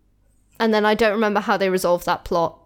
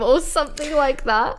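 ordinary talk, nothing marked

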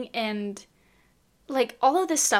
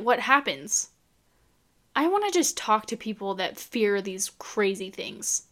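ordinary talk

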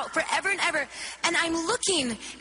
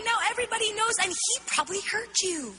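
higher-pitched, mildly angry self-reproach; mock-angry inner scolding; 6 semitones higher, faster than usual. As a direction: scold yourself, retelling it for an audience. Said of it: "I felt so angry".

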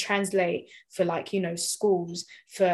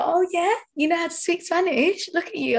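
mock impressed; 10 semitones higher, 3 dB louder than usual. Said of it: "And I'm just like".

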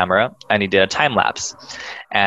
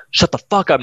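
high-pitched mild outburst; mock a friend freaking out; retold freak-out; 8 semitones higher than usual. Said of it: "Now he's, like, freaking out".

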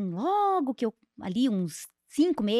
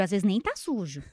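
ordinary talk

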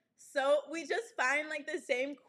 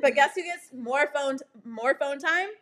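ordinary talk

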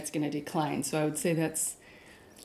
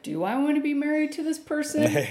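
quoting a pandemic spouse; 9 semitones higher, 3 dB louder than usual. Retold or imitated. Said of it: "was like".

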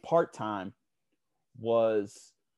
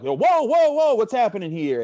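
loud, much higher startled cry; loud high alarmed whoa; alarmed shout; 12 semitones higher, 10 dB louder than usual. Retold or imitated. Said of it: "we're like".

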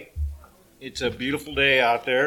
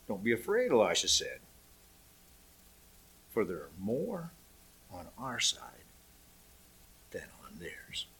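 reassuring; 6 semitones lower, 10 dB quieter than usual. Acted. Elisha calms frightened servant.

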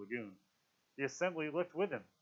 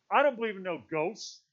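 loud, higher-pitched protest; indignant; 7 semitones higher, 6 dB louder than usual. A narrator's reading. Piggy takes the conch to deny ghosts, indignant. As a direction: protest it firmly, voice raised. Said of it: "His voice was indignant".

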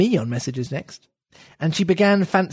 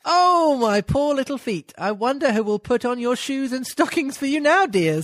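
put-on lightly raised, pitying little-girl voice; gently higher Alice, half amused; 6 semitones higher than usual. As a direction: coo over her poor little feet. Reads Alice's lines aloud.

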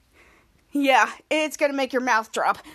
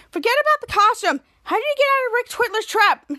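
higher, louder pointed question; imitating Charlotte; 7 semitones higher, 4 dB louder than usual. Charlotte grilling Captain Man, pointed. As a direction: press him for answers.

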